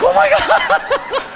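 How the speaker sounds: very high, slow, freaked-out gasp of words; shocked squeal. Retold or imitated. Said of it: "I'm like".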